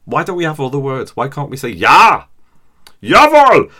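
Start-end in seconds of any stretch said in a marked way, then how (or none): 1.7-3.7 shouted German. Pretend. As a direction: bark "Jawohl!" very high and loud. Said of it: "if we shouted that in a restaurant".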